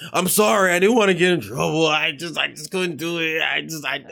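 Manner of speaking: imitating a crying friend. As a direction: play the scared crying friend, half mocking. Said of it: "He's like".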